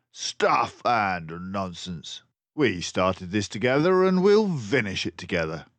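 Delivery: brave refusal to turn back; resolute Dawson. A narrator's reading. Dawson squares shoulders.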